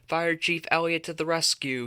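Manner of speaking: read-aloud character yell. A narrator's reading. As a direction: call it out like a proud fire chief. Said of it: "he yelled".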